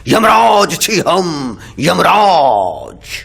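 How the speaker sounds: slow, drawn-out proclamation as god of death; grand self-announcement. Acted. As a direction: announce yourself grandly, stretching the name out. Yamraj proudly announces who he is.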